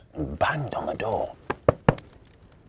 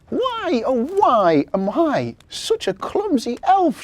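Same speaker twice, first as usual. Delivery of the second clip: high, slow, loud elf wail; acted sobbing; 14 semitones higher, 8 dB louder, slower than usual. A narrator's reading. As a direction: wail it like a tearful little elf. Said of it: "she sobbed".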